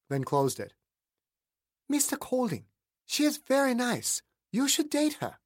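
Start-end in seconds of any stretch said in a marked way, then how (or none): 1.9-5.4 put-on a childlike higher Jean; Jean's voice. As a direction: voice Jean sweetly and knowingly. Jean's voice in story.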